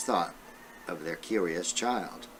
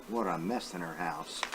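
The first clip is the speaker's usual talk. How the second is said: soft, lower aside to the class; teacher aside; 4 semitones lower, 7 dB quieter, faster than usual. Real, not acted. Teacher pausing the story to chat softly with the kids.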